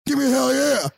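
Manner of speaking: high shouted wrestling promo; booming wrestler boasts. Pretend. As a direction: scream the trash talk to the crowd. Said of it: "impression of Stone Cold Steve Austin".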